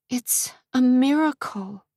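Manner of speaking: put-on guard the baby in your words; defensive. A narrator's reading. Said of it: "defensive of her unborn child".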